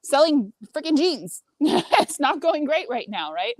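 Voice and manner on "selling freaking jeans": retold exasperated retort, pitched high; higher-pitched, fed-up comeback; exasperated complaint. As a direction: complain indignantly, voice jumping up. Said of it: "And I was complaining".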